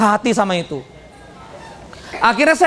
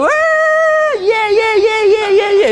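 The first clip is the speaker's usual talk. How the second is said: crowd cheering; 11 semitones higher, slower than usual. Retold or imitated. Audience screaming as the motivator walks on, ecstatic. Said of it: "Teriak-teriak".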